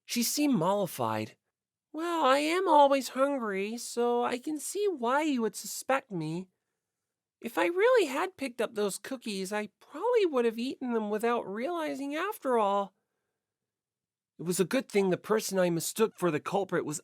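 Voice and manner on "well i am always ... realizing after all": put-on higher, bright girlish voice; cheerful sporty girl. Narrator voicing Asahina shrugging off the suspicion, girlish higher voice. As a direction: play her as easygoing and sheepish.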